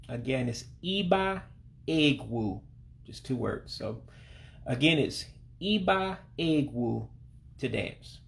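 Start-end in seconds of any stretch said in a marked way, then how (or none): 0.8-2.6 iba egwu in two separate words, high ee first; high I sound. Shown as a demonstration. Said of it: "real, real high I sound". 5.6-7.1 iba egwu, starting on a high ee; careful teacher's model of iba egwu, word by word; high I sound. Shown as a demonstration. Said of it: "real, real high I sound".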